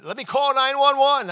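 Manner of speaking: high, loud panicked plea from a frightened father; father panicking as his son thrashes, shouting. Retold or imitated. Panicked father during manifestation.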